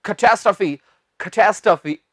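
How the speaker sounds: unbroken "kuh-TAS-truh-fee" for catastrophe; joined as one word. Shown as a demonstration. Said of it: "एक साथ बोलना है आपने".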